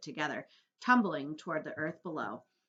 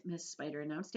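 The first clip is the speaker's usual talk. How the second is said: ordinary delivery, nothing marked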